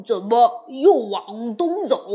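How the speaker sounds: loud whiny complaint; grumbling Bajie. Acted. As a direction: whine loudly at your big brother. 八戒抱怨往东走. (八戒大声抱怨)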